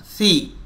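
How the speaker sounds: सी with the tongue between the teeth, like th; correct tongue-out see. Shown as a demonstration. Teacher shows सी with the tongue out past the teeth. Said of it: "ज़बान थोड़ा सा दो दांतों के बाहर".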